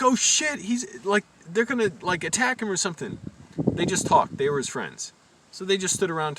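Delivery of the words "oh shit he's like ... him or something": alarmed. Retold or imitated. Alarmed inner thought retold.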